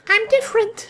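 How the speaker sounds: very high robot turret voice. Retold or imitated. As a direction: squeak it like a little robot turret. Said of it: "my favorite quote of the turrets is".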